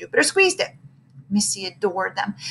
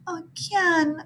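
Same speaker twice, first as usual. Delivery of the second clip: sighing; 7 semitones higher, 6 dB quieter than usual. A narrator's reading. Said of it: "sighed Missy".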